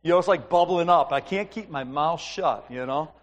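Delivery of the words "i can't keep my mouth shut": normal talking voice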